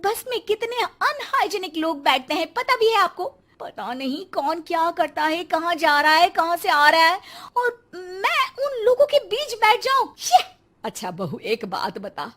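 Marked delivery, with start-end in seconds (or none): 0.0-10.4 higher, whiny protest; disgusted whine. Acted. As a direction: squeal in disgust at the thought. Bride whines about bus.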